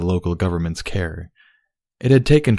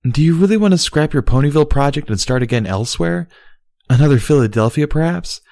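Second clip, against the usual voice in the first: Luna needling; 6 semitones higher, 4 dB louder than usual. A narrator's reading. Luna voiced in story.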